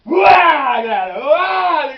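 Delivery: huge high shouted boo; a sudden ambush yell to make the kids scream; scare yell. Retold or imitated. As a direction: jump out and roar at them. Police jump out to scare kids.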